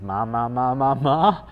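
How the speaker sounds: playful mimicry of a babbling baby; imitated baby babble. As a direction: babble like an infant finding a first word. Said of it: "he says".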